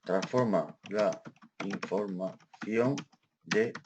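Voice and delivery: slow and soft, in separate syllables; low-volume, halting dictation pace. Real, not acted. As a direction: say it bit by bit while typing. Dictates aloud while typing.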